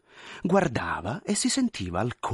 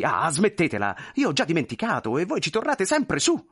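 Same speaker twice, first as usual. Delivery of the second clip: lightly voiced irritated old official; impatient general; 6 semitones higher than usual. General losing patience with the clerk, lightly voiced irritation. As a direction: brush him off with annoyance.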